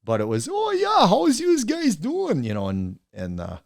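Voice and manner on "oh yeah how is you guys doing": very high, loud exaggerated Wisconsin accent. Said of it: "Wisconsin accent".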